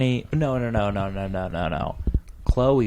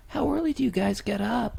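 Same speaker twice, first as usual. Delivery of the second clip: high nervous dork voice, pitched way up; imitating a jittery nerdy guy; mocking Scott as a high squeaky Marty McFly type; 11 semitones higher than usual. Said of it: "sound like Marty McFly".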